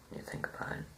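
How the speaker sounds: soft unvoiced aside; a quiet muttered afterthought; whispered aside. Real, not acted. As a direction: whisper it to yourself.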